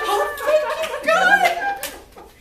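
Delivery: high, loud, slow and laughing; laughing shout of relief; overjoyed relief. Retold or imitated. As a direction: cry out thanks to God. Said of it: "And you're like".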